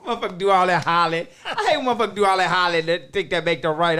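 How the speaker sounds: loud amused complaint; laughing rant. Real, not acted. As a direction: laugh as you vent. Laughing rant about hollering.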